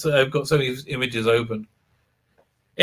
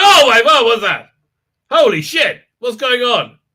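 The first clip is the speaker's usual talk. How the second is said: high, loud startled outburst; mock alarm; 8 semitones higher, 5 dB louder than usual. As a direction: react in hammed-up shock. Acted startled reaction to sudden sight.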